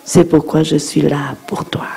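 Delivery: whispered tender reassurance. Shown as a demonstration. Tender words to father. (chuchoté, tendre)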